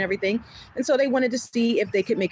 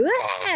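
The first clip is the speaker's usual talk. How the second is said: mock outburst; 11 semitones higher than usual. Imitating herself blacking out.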